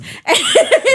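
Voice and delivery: bursting into a high-pitched laugh. Real, not acted. Friend laughing while retelling the silly song, high giggle. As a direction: laugh out at how silly it was.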